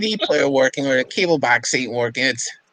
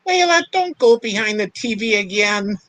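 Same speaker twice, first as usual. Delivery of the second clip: high-pitched call to the pet; 7 semitones higher than usual. Real, not acted. Owner tells Layla off for the TV, high scolding call. Calling to pet mid-chat.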